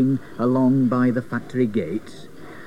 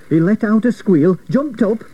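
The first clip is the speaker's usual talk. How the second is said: ordinary delivery, nothing marked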